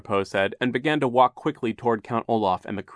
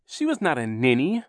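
put-on mildly raised boy's protest; indignant cry; 5 semitones higher, 3 dB louder than usual. A narrator's reading. Boy defending his aunt, mildly indignant cry. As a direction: protest with a higher voice. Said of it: "Klaus cried".

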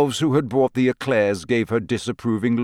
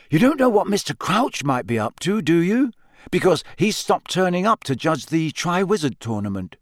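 put-on young boy voice; 8 semitones higher than usual. A narrator's reading. Harry voiced in the story.

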